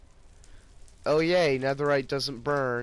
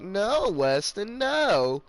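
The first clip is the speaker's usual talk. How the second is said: slow, higher, drawn-out no; firm refusal; 7 semitones higher, 3 dB louder, much slower than usual. Retold or imitated. Vlogger retelling refusing Weston, slow drawn-out higher no. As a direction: stretch it out slowly, refusing him. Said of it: "and I'm like".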